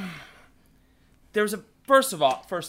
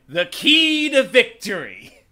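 slow grand proclamation; 5 semitones higher, 8 dB louder, slower than usual. Acted. Slow grand catchphrase delivery.

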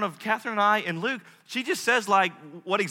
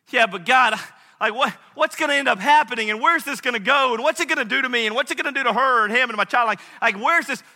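loud, high, tumbling questions; anxious questioning; 7 semitones higher, 9 dB louder than usual. Acted. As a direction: press God frantically for answers.

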